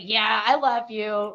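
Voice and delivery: slow, dry, mock-sincere yeah; sarcastic. To her mirror self, a sarcastic slow I love you. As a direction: say it like you don't mean it at all. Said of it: "I was kind of being sarcastic about it".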